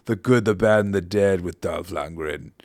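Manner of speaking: playful mock Austrian accent; Arnold Schwarzenegger. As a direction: punch the title in a put-on Austrian accent. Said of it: "I'm doing an Arnold Schwarzenegger accent".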